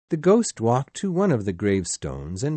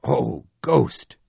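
a loud, desperate cry to the ghost; anguished cry; 3 semitones higher, 6 dB louder than usual. Acted. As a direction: cry out in dread. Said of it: "Scrooge cried".

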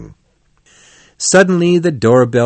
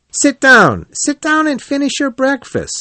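high-pitched cheerful rat; 13 semitones higher, 3 dB louder than usual. A narrator's reading. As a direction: invite them brightly, pitched well up. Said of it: "said the Rat cheerfully".

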